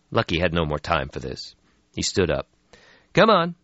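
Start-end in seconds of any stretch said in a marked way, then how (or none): 3.1-3.6 loud, high call to go; urging. Acted. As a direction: hurry him along. Lucky voiced in story.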